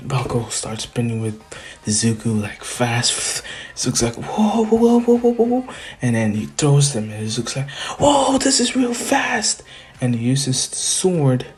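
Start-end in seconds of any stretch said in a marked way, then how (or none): 4.2-5.7 high string of dizzy yelps; mock panic, pitched way up; alarmed whoas. Izuku being spun around fast, alarmed. 8.0-9.6 high, stretched-out mock wail; hammed-up panic at the speed; alarmed cry. Izuku flung through the air, alarmed. Acted cry while thrown fast.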